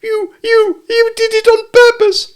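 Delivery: very high, loud stuttered squeal; spluttering. A narrator's reading. Piglet with a mouthful of soap accusing Kanga, spluttering. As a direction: splutter indignantly, repeating the first word. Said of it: "spluttered Piglet".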